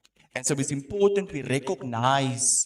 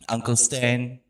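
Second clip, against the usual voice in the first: ordinary delivery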